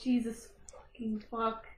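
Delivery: breathy shocked whisper; whispered curse. Real, not acted. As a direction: hiss it under your breath. Shocked player reacts to horror.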